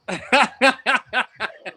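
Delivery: high, loud laughter; loud burst of laughter. Real, not acted. Laughing at his own mistake. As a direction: crack up laughing.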